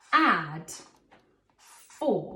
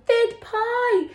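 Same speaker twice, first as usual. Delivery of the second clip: "Fid pie" much higher and louder, full of emotion; excited alien; 13 semitones higher, 5 dB louder than usual. Shown as a demonstration. Alien greeting children, hammed-up excitement. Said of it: "meaning it with a lot of emotion".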